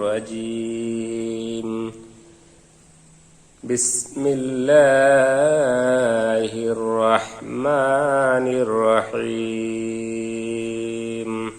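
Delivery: slow, chanted tajweed recitation; Quranic recitation. Acted. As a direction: intone it slowly like a reciter.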